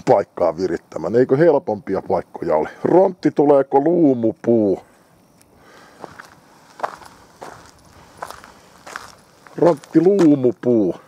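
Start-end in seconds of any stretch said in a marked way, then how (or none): 2.8-10.9 coaxing the dog. Real, not acted. Calling to his dog.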